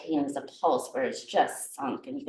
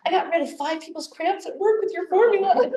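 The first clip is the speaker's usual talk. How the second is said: excited quote; 12 semitones higher, 6 dB louder than usual. Retold or imitated. Quoting student's delighted text.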